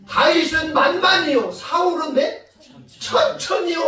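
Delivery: high, loud acted acclaim. Women greet warriors returning from battle, loud acclaim. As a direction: call it out to the crowd.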